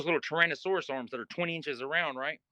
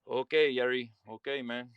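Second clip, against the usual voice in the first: put-on Spanish accent; 2 semitones lower than usual. Spanish-speaking cellmate reassured by Jerry, lightly imitated Spanish accent. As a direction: mimic a Spanish speaker's English, easing off. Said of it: "He don't speak no English".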